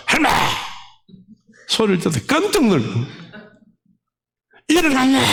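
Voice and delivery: high, startling exclamation; sudden exclamation. Retold or imitated. Preacher startles the grandmother who has risen, high exclamation. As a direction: burst out as if with a sharp clap. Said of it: "박수를 딱 치면서".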